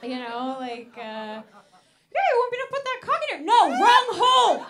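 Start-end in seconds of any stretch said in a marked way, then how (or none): 1.0-3.5 high, loud mocking puppet voice; puppet voice dirty talk. Boyfriend repeating dirty talk in puppet voice. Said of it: "so weird hearing everything back". 3.5-4.7 shouted protest. Retold or imitated.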